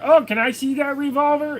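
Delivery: put-on high eager kid voice; whiny kid. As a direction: beg like an excited child. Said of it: "they're like".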